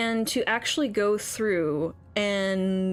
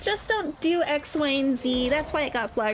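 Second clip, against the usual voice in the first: high sing-song mockery; mocking high voice; 7 semitones higher than usual. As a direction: parody of a smug support reply. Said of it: "they finally go".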